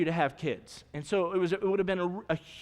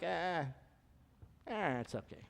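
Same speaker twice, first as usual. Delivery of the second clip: unimpressed; 3 semitones lower, 11 dB quieter than usual. Retold or imitated. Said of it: "they're like".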